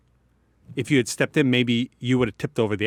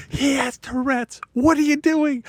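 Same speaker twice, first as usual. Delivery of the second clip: much higher, alarmed shout, slow at first; retold yell. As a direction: cry out to stop the misunderstanding. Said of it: "she, she yelled it".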